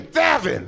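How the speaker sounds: "Thevin" called out with a lisped th; high-pitched lisping mom saying "Thevin"; lisped name. Acted. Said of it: "Your mom has a lisp".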